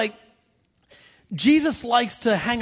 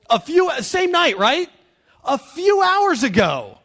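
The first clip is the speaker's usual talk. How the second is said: exasperated; 7 semitones higher, 6 dB louder, slower than usual. Real, not acted. Preacher exasperated at Peter.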